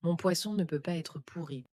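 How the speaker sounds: usual speaking voice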